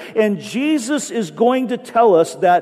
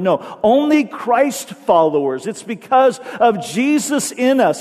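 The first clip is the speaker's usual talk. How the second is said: ordinary delivery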